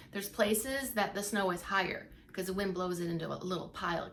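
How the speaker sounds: usual speaking voice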